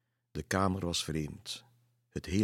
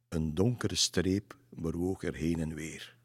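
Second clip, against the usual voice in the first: ordinary delivery, nothing marked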